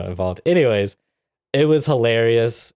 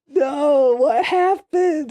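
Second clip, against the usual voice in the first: much higher, very slow, mock-anguished plea; squeaky, stretched mock disbelief, much higher; hammed-up wail; much slower than usual. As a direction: overplay the despair like a cartoon. Repeats reaction, more exaggerated.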